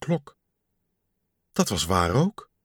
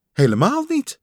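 much higher, bright denial; cheery Kikker; 12 semitones higher, 3 dB louder than usual. Acted. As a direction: brush the worry off brightly. (vrolijke Kikker)

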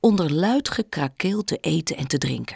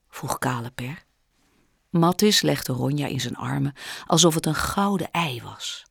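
usual speaking voice

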